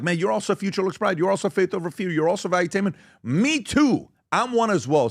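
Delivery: high-pitched, delighted mutual recognition; excited fans. Retold or imitated. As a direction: play fans thrilled to spot one another. Acting out fans greeting each other.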